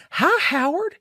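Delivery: a falsely sweet wife greeting; sweet creepy greeting. Retold or imitated. Pearl greeting Howard in a high sugary voice. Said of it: "she's like".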